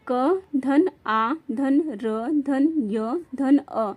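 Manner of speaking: very slow, letter by letter; slow spelling out. Shown as a demonstration. Teacher spelling out कार्य letter by letter, very slow. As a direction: space each letter out for the class. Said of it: "spelling out letters for students".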